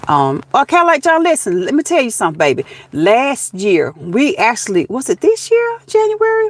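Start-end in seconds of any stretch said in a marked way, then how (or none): 4.9-6.5 high unsure. Real, not acted. Unsure of the date.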